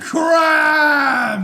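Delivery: loud, high yell; shouting. Pretend. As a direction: bellow it out. Shouting a game catchphrase.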